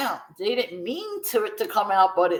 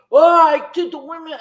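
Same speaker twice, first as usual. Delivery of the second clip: higher, louder sneering take on a rival's boast; mocking imitation; 6 semitones higher, 9 dB louder than usual. Mocking a rival dancer's bragging claim, hammed-up imitation. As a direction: mimic his smug brag with a mocking lift. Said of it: "But he's like".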